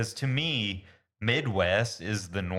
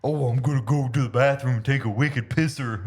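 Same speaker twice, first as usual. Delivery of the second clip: higher, louder mock voice; mocking the wife; 4 semitones higher, 6 dB louder than usual. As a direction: parody someone who doesn't get it. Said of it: "they're like".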